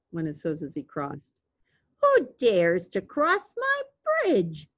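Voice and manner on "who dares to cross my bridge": slow, high-swooping troll challenge; drawn-out, theatrical troll voice; growling troll. A narrator's reading. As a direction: growl it as a mean old troll. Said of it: "growled the mean old troll".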